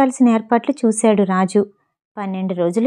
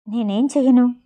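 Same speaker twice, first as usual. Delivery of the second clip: ordinary delivery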